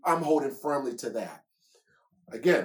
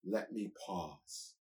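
donkey thinking aloud; 5 semitones higher, 4 dB louder than usual. Acted. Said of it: "That is a donkey saying".

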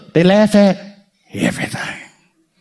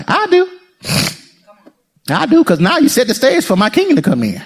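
ordinary talk, nothing marked

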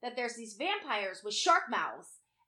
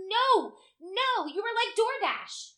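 high, loud, comic outrage; worked-up mock tirade; exasperated rant; 7 semitones higher, 4 dB louder than usual. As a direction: scold the fools at a raised pitch. Exasperated rant at shark swimmers.

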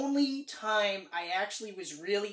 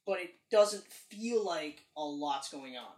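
ordinary delivery

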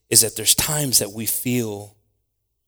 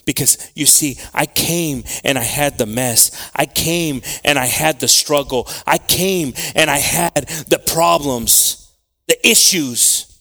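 building preacher's cry, slowing; rising, hammering each I came; preaching at full force; 4 semitones higher, 6 dB louder than usual. Real, not acted.

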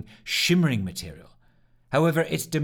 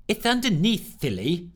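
tutting; 7 semitones higher than usual. Acted. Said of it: "tutted Simone".

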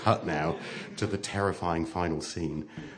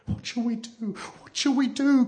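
despairing cry. A narrator's reading. Children trapped by the knocking, crying in despair. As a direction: panicky childish helplessness. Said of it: "cried the children in despair".

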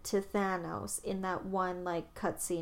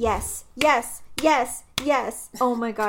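excited; 3 semitones higher, 9 dB louder, slower than usual. Real, not acted. Finally gets to time travel topic.

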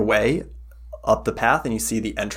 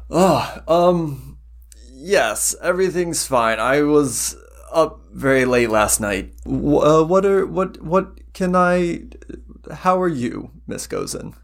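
flustered priest; 6 semitones higher than usual. Acted.

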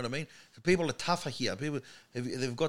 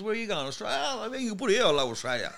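acted-out banter between emigrants; разыгранный диалог в очереди; 7 semitones higher, faster than usual. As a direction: toss it off as a lively mock exchange. Acting out emigrants meeting in queue.

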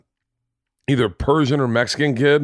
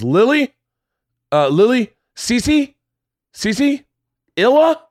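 high, questioning name calls; barista calling out; 12 semitones higher, 5 dB louder, slower than usual. Retold or imitated. Woman at Starbucks hunts for the customer, calling out. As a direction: call out uncertain guesses, each lifting upward.

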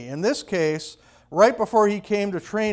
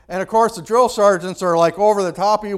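ordinary talk, nothing marked

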